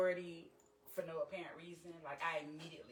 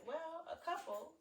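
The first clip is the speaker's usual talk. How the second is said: high, slow coy answer; a hint of teasing coyness; coy reply; 11 semitones higher, 3 dB quieter, slower than usual. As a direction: drag it out teasingly. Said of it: "I said".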